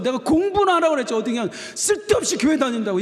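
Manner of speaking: aunt's scolding. Retold or imitated. Aunt nagging her niece about church, angry fast scolding. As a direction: snap at her like an exasperated aunt.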